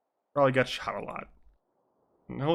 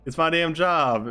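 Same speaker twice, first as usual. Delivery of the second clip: slow, louder Southern drawl; Southern accent; 4 semitones higher, 7 dB louder, slower than usual. Acted. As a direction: insist on it in a higher Southern drawl. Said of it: "Why does it keep going southern immediately?".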